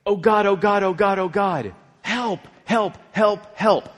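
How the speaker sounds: high, loud, repeated plea for help; panicked prayer. Retold or imitated. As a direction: act out a desperate emergency prayer. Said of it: "And I prayed".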